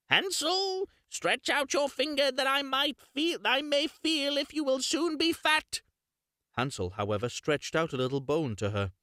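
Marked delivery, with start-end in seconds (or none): 0.1-5.8 witch calling. Acted. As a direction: croon for his finger through the bars. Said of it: "crept into the little stable and cried".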